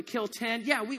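usual speaking voice

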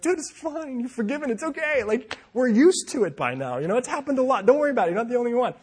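high, loud, hasty brush-off; acted-out overeager forgiving; awkward reassurance. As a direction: wave away an apology in an embarrassed high voice. Acted hurried reassurance to embarrassed man.

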